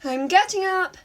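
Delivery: groggy shout through the door; answering a friend's wake-up call, yelling back. A narrator's reading. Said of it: "she yelled back".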